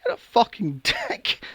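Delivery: high, outraged, laughing through it; indignant rant. Real, not acted. Outraged at the pilot for scaring Ellen. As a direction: spit out the insult with a laugh.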